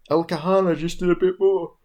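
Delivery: talking through a yawn; words stretched by a yawn. Real, not acted. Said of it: "I get shit for yawning".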